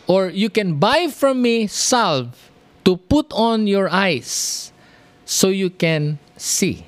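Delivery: slow, lifted scripture reading. Acted. Preacher reading verse eighteen to the church, slow and lifted. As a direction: read the Lord's words slowly and solemnly. Reads scripture aloud in sermon.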